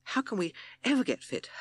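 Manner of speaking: a mild puffed-out gasp; Captain Beaky, winded from running, complaining; panting. Acted. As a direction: catch your breath mid-jog. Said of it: "he panted".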